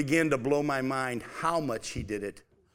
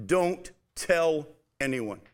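each word drawn out: "Don't tell anyone"; slow emphatic command; much slower than usual. Shown as a demonstration. Preacher slowing down on Jesus' words "Don't tell anyone". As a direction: lay it down slowly so they don't miss it. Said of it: "don't miss this".

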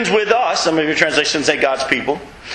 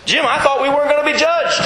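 pitched-up, put-on voice of a puzzled churchgoer; mock whiny objection; 10 semitones higher than usual. Preacher mimics a congregant protesting to Jim, pitched-up whine. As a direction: play the naive believer caught off guard.

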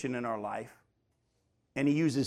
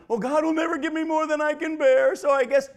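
high, mocking singsong of a smug churchgoer; mocking complacent believer; 10 semitones higher, 4 dB louder than usual. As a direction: parody the people who lean on this saying, pitched well up. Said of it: "Too many people, we've gone around and".

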